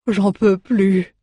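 slow, worn-out dwarf grumble; exhausted dwarf. A narrator's reading. The dwarf refusing to get up after the crossing, slow and exhausted. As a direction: drag out every word, spent.

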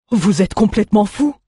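high, alarmed exclamation; exclaiming. Acted. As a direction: scold them, aghast. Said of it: "s'exclama le Tarnéen".

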